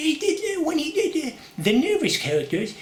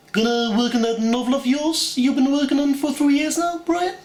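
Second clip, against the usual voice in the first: much higher cartoon baby voice, mock posh; Stewie Griffin; 8 semitones higher, 3 dB louder than usual. Stewie taunting Brian about his novel, high cartoon voice. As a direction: sneer it up high like a cartoon toddler. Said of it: "do Stewie Griffin from Family Guy".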